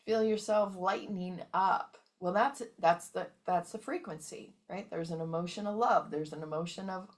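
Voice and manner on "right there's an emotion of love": ordinary talk, nothing marked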